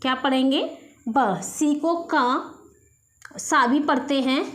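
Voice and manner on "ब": ब as just the consonant sound with a light a; letter sound b. Shown as a demonstration. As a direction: say ब as a short clipped b sound, not the letter name बी. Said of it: "बी न पढ़ के ब पढ़ेंगे".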